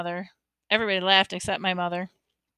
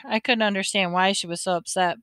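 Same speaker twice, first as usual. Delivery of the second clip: ordinary delivery, nothing marked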